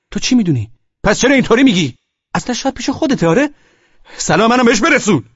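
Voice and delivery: high, loud, suspicious attack; angry accusation. Acted. Husband accuses friend of knowing wife's whereabouts, angry accusation.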